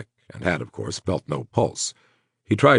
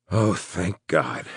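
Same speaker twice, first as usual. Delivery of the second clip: slow, sighing relief; heavy sigh; slower than usual. A narrator's reading. Wounded man learns she is okay, sighing slowly in relief. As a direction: breathe the words out in relief. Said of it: "Breathing out a heavy sigh".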